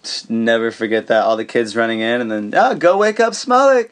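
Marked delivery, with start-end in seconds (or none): 2.6-3.9 higher mock kid voice urging mischief; lightly voiced excited kid; kid egging others on. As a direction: play a giggly kid daring friends. Kids egging each other on.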